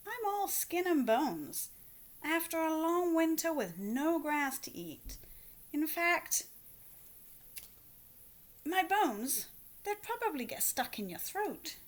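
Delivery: a goat talking his way out; middle goat voice. A narrator's reading. Middle goat's voice.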